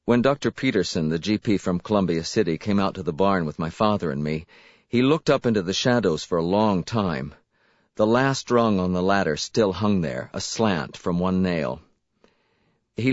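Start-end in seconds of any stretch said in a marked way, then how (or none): none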